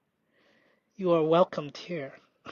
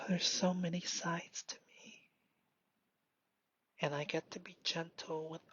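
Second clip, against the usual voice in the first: hushed, tender whisper; whispering kindly to yourself; 13 dB quieter than usual. Real, not acted. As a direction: confide it in a whisper. Whispered self-talk.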